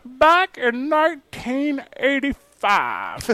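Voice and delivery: slow, high mock newsreel voice; old newsreel narrator. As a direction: overblown vintage announcer. Mocking the old-timey voice.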